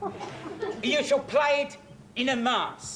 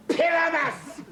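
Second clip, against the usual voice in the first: emphatic shout; 7 semitones higher than usual. Acted. Quince firmly insists on the part.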